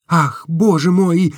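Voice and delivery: high, slow exclamation; exasperated Nastenka. A narrator's reading. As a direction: interrupt him, impatient. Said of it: "перебила Настенька". (высоко и медленно, нетерпеливо)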